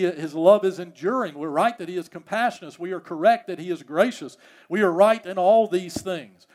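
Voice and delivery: ordinary talk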